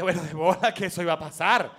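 knowing remark. Retold or imitated. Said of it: "tú dijiste".

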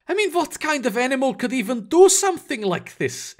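high-pitched character voice, disbelieving; incredulous Eric. Acted. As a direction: ask it aghast, voice pitched well up. Plays Eric, appalled by the deaths.

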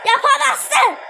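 loud high yell; taunting shout. Real, not acted. Taunting the opponent.

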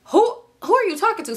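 high-pitched, indignant disbelief; incredulous challenge. Retold or imitated. Woman confronting her delusional boyfriend, high incredulous challenge. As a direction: demand it as if he has lost his mind.

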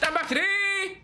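high, gleeful cheer; high-pitched gamer celebration; excited call-out. Real, not acted. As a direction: cry out the move's name in triumph. Celebrates the move again.

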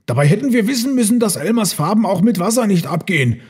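high, exclaiming character voice; tricked elephant. A narrator's reading. Herd member sees through Elmar's joke, high exclamation.

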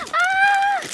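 loud, very high squeal; delighted scream. Real, not acted. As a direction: scream as the surprise sinks in. Screams at next gift.

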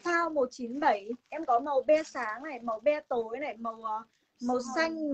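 ordinary delivery

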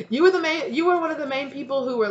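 high, loud mock outrage; indignant accusation. Acted accusation against betraying friend.

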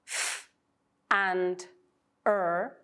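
"f" and "er" said apart as single sounds; separate sounds. Shown as a demonstration. Teacher breaking the second blend into parts. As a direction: pull "f" apart from "er".

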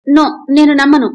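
a sharp, high and loud cry of refusal; loud shout. Acted. Wife shouts at husband before breaking down, loud and high. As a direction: shout it out, she won't believe him. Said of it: "అంటూ గట్టిగా అరుస్తూ".

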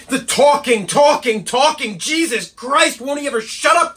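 high, exasperated rant; angry outburst. Acted. Assistant raging about his self-absorbed boss, angry. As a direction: explode in exasperation at his endless talk. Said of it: "he's quite angry".

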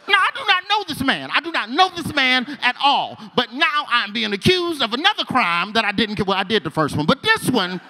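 high, loud, frantic denial; panicked protest. Retold or imitated. Wrongly accused new inmate, high panicked protest.

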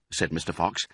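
usual speaking voice

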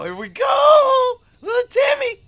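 very slow, high, loud; stretched-out hype yell; hyped shout. Real, not acted. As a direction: belt it out like a war cry. Hyped starting the run.

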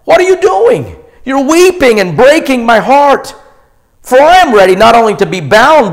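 high, loud, anguished apostle's plea; Paul pleading. Acted. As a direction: cry out as Paul to weeping friends. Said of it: "Then Paul replied".

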